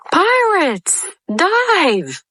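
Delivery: high urgent shout; crying out. A narrator's reading. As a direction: yell the warning. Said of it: "Peter jumped up and cried".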